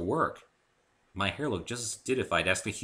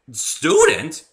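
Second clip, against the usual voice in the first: high, loud astonished question; stunned echo; astounded; 14 dB louder than usual. A narrator's reading. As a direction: gasp out the word in disbelief. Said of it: "I asked, astounded".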